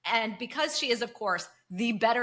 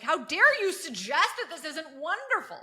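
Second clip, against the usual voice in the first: pitched-up indignant gasp of a quote; mock outrage; 7 semitones higher than usual. Mocking the cultish green mood, pitched-up mock indignation. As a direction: play the scandalized zealot, hammed up.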